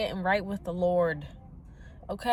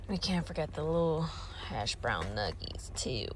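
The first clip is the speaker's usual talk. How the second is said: normal talking voice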